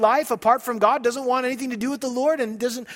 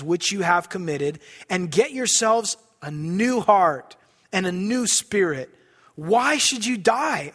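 scripture reading; 5 semitones lower, slower than usual. Acted. Reads scripture aloud, low and slow.

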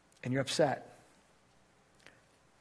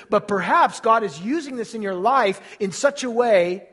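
loud, high fervent preaching; preaching at full force; 6 semitones higher, 9 dB louder than usual. Acted. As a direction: build the sermon to a loud climax.

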